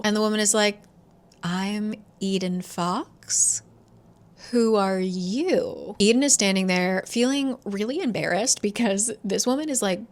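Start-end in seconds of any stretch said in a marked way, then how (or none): 1.4-6.0 very slow, deliberate reply; poised impostor. Retold or imitated. Woman at the door claiming Eden's name, poised and deliberate. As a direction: draw it out, coolly turning the question back.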